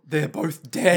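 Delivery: mock horror. Acted horrified discovery of bodies.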